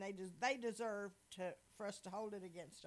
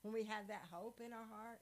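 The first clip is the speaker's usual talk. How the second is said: ordinary delivery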